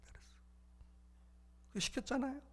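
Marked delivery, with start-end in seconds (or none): none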